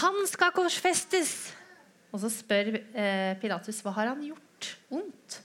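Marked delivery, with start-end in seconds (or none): 0.0-1.5 high, slow, drawn-out shout; crowd shouting. Acted. The crowd shouting to Pilate, slow high shout. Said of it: "Og alle roper". 3.8-5.4 high, slow, questioning plea; pleading Pilate. Acted. Pilate pleading with the crowd, slow and high.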